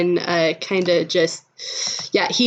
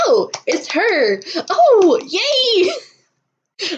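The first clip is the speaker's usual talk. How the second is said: high, gleeful cheer that jumps louder; delighted recognition; 12 semitones higher, 4 dB louder, much slower than usual. Acted. Sammy sees his friend in disguise, delighted squeal. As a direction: cry it out with rising delight.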